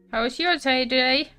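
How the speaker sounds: ordinary delivery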